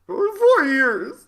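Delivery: mocking imitation. Mocking S2's line theatrically.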